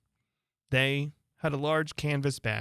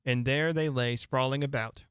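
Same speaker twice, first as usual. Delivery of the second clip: ordinary delivery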